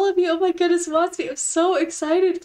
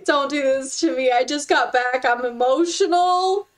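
ordinary talk

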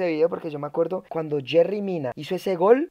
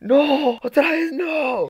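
high, drawn-out wail of protest; mock dismay; 9 semitones higher, 4 dB louder, much slower than usual. Streamer dreading it happening again, high mock-despairing wail. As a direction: wail it slowly, hammed-up.

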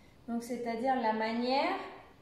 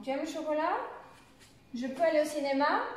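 questions rising on CHOCOLAT and CINÉMA; interrogative intonation; 3 semitones higher, faster than usual. Shown as a demonstration. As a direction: let "chocolat" and "cinéma" climb right up at the end.

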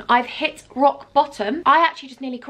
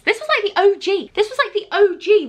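higher, excited recognition; excited nostalgia; 8 semitones higher than usual. Real, not acted. Vlogger thrilled to rediscover her old kit, excited.

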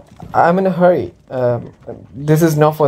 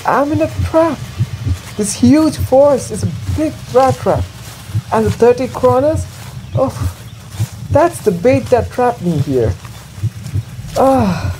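higher, rising panic as the trap dawns on him; frightened realization; 7 semitones higher than usual. Acted. Lost peddler realizing the forest is a trap, high and frightened. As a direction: sound trapped and scared. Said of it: "realized with fear".

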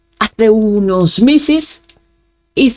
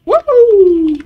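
excited cheer; 10 semitones higher, 4 dB louder than usual. Real, not acted. Cheering at something in game.

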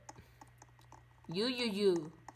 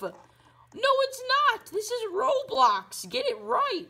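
higher and louder, outraged correction; indignant yell; 13 semitones higher, 6 dB louder, slower than usual. Acted. Correcting the other player about Roblox, indignant yell. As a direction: snap back that it's only a game.

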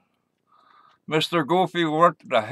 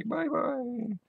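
put-on a light, higher-pitched girlish "Bye-bye."; Japanese girl voice; 9 semitones higher, 10 dB quieter than usual. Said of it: "And the Japanese girl say".

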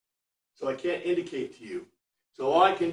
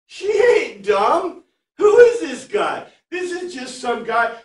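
higher, louder mock scorn, slow; scornful; 11 semitones higher, 9 dB louder, slower than usual. Teacher voicing the Samaritan woman's scorn, high and loud. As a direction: sneer it like she's sizing him up.

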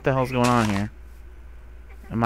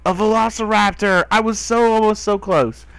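excited; 8 semitones higher, 4 dB louder than usual. Real, not acted. Spots a velociraptor.

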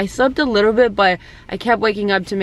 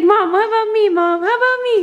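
put-on baby's voice; 12 semitones higher than usual. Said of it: "She's like".

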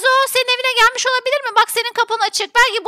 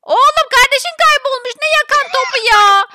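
loud, higher-pitched reproach; exasperated scolding; 4 semitones higher, 4 dB louder than usual. Acted. Mother scolding son who wants to play ball, exasperated. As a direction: snap at the child in disbelief.

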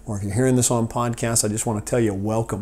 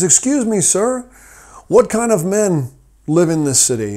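eager traveler; 7 semitones higher, 4 dB louder than usual. Acted. Second traveler asks wise man.

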